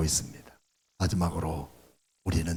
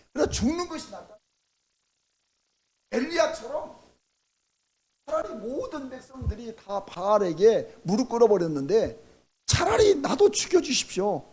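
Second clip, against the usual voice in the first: anguished plea; 10 semitones higher than usual. Acted. Pleading like Elijah for death.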